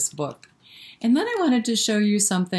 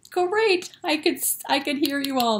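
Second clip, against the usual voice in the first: delighted praise; 9 semitones higher than usual. Real, not acted. Praising children after song.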